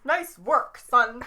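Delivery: loud, proud anchorman pat on the back; mock anchorman praise; Ron Burgundy line. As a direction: bellow it like a proud newsman.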